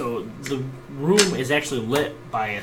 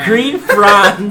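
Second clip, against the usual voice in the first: game master narration; 9 semitones higher, 14 dB louder than usual. Acted. Scene narration, loud.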